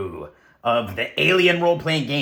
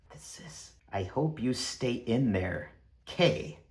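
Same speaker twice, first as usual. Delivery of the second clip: whispered reading; 2 semitones lower, 8 dB quieter than usual. Acted. Reading a mysterious note.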